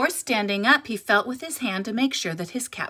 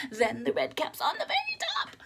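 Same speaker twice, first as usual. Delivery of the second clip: laughing; 5 semitones higher, 6 dB quieter than usual. A narrator's reading. Stacking caps again.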